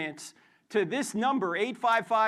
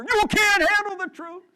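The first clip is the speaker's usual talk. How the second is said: much higher, louder yelled line; 13 semitones higher, 10 dB louder than usual. Pretend. Student yelling at the professor, full yell. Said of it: "just yell at the professor".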